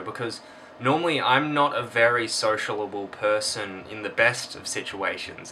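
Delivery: normal talking voice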